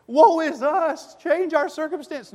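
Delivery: high, slow, hammed-up lament of woe; mock wail. Preacher mocking a fearful church's prayer, high mock wail. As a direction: whine it like a panicked church. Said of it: "No, they said".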